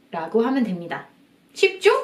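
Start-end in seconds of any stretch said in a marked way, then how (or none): 1.5-1.9 bright, teasing high check-in; a much higher, louder "쉽죠?"; playful. Real, not acted. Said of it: "teasing question to viewers".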